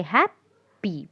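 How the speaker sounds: "happy" slowly broken as hap, pause, py; split into syllables. Shown as a demonstration. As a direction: say "happy" in two separate beats, hap and py. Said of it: "two syllables in this word".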